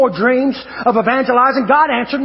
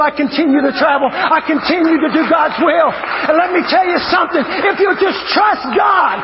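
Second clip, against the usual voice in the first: high, building revival shout; full-force preaching; 7 semitones higher than usual. Real, not acted. Preacher urging the church to trust God, full-force preaching. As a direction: drive it upward, stretching key words.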